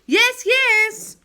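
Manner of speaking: high, loud call from below. A narrator's reading. Children calling up to Joe, loud and high. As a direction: voices shouting up the chimney. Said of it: "called six voices below him".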